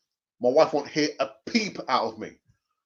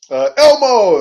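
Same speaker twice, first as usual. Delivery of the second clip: higher, much louder greeting; sudden happy shout of a name; surprised call; 9 semitones higher, 14 dB louder, slower than usual. Real, not acted. Suddenly recognizing a chat name.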